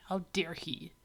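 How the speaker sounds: whispered, indignant; seething whisper. Pretend. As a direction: hiss it under your breath, mock-offended. Voicing Russia's seething thought.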